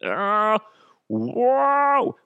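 high, drawn-out wobbling cry; comic high-pitched yowl; alien voice like Bobcat. Pretend. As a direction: let out a goofy high alien whoa. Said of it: "Almost like Bobcat".

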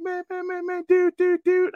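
squeaky, exaggerated Baltimore accent, repeating man and dude; Gervonta Baltimore accent. Host mimicking a boxer's post-fight interview, high mock Baltimore accent. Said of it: "the Baltimore accent kills me".

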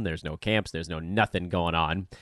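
usual speaking voice

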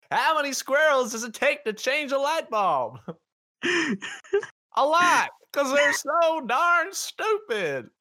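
very high, laughing cartoon voice; squeaky silly joke voice, slow at the end; goofy high voice.

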